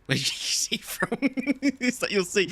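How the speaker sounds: retold question dissolving into high laughter; cracking up. Retelling his nagging question to Sav, cracking up. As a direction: start the quote and lose it laughing.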